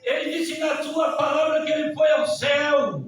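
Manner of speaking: high, loud pulpit proclamation; full-force preaching. Real, not acted. Preacher proclaiming Christ's ascension, loud and high. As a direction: proclaim it loudly to the church.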